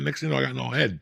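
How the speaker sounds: normal talking voice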